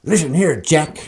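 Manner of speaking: ordinary talk, nothing marked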